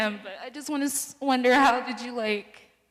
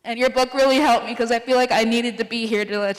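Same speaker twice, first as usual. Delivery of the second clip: usual speaking voice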